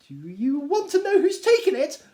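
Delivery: impatient Mrs Bennet. Acted. Wife pressing her husband, high and impatient. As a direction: push him, she cannot wait. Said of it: "cries his wife impatiently".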